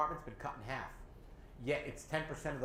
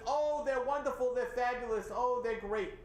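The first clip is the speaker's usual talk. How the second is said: mock gushing; 6 semitones higher, 3 dB louder than usual. Caller mimics everyone praising two assistant supervisors, mock gushing. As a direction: imitate over-the-top praise, high-pitched.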